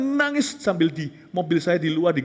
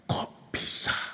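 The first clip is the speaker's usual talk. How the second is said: very soft slow whispered Kok bisa; 9 dB quieter, much slower than usual. Retold or imitated. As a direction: breathe it out like a voice in the heart. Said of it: "Roh Kudus berkata". (bisikan pelan dan lambat)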